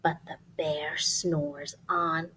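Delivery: slow breathy hush of the refrain; soft slow whispered refrain. Acted. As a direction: keep it secret so the bear sleeps. Refrain whispered over sleeping bear.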